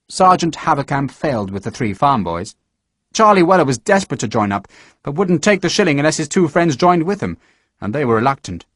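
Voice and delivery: ordinary delivery, nothing marked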